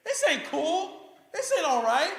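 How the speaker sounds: high, loud complaint; indignant protest. Acted. Childless believer protesting unfairness to God, high and indignant. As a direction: cry out at how unfair it is.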